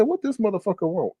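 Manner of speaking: soft, acted grumble of suspicion; wary aside. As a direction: say it under your breath to yourself. Retold aside as stranger approaches.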